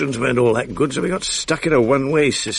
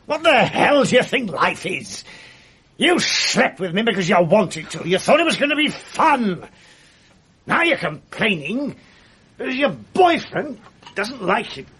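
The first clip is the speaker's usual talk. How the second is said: higher and sharper, rising fury; angry outburst; 9 semitones higher than usual. Acted. Man rounding on the woman blaming him, angry raised voice. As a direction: turn on her, voice climbing in anger.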